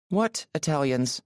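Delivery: high woman's voice; Aurora, questioning. Acted. Woman questioning her driver's teasing, high and puzzled. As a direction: query it in a high, accented voice.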